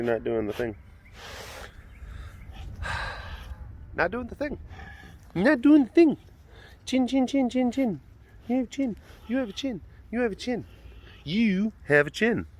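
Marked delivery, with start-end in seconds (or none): 4.0-6.2 pet talk. Real, not acted. Same words cooed to cat. 6.8-12.5 pet talk. Real, not acted. Baby-talking about cat's chin.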